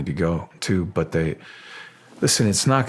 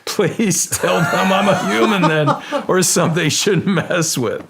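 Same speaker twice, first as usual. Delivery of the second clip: pleading to God, much higher and louder, laughing through it; a half-laughing, hammed-up plea for rescue; laughing mock prayer; 10 semitones higher, 4 dB louder, slower than usual. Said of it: "And I say".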